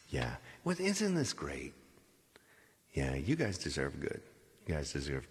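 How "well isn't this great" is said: ordinary delivery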